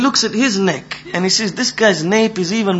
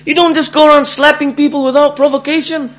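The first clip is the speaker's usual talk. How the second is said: high-pitched acted indignation; indignant scolding; 8 semitones higher, 4 dB louder than usual. Slapped man telling off the old man, indignant. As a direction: scold him in a raised, outraged voice.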